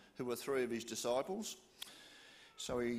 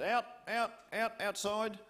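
higher, louder ordering out; firm command; 7 semitones higher, 4 dB louder than usual. Acted. Jesus putting mourners out, firm command. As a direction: clear the room firmly. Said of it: "He said".

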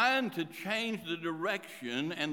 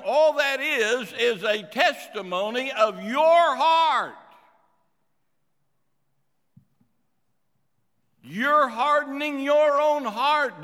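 loud, raised-pitch rebuke from the pulpit; full-force preaching; 7 semitones higher, 9 dB louder than usual. Real, not acted. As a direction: drive each phrase home loudly to the congregation.

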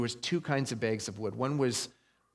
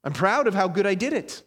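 higher, louder smug bragging voice; self-righteous boaster; 6 semitones higher, 7 dB louder than usual. Retold or imitated. Pastor mocking a self-righteous believer, loud smug brag. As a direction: play a preening religious show-off. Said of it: "The second one is".

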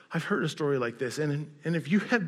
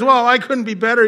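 loud, raised-pitch sarcastic retort; 8 semitones higher, 10 dB louder than usual. Retold or imitated. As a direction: imitate a prisoner's sarcastic comeback, loudly. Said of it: "he says in a sarcastic way".

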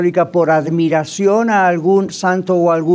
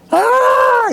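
high shrill imitated shriek of swooning fans; fan scream. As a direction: mimic the women screaming for a star. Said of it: "las mujeres empezaron a gritar". (grito agudo imitando a fans)

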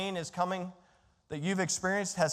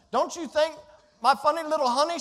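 ordinary talk

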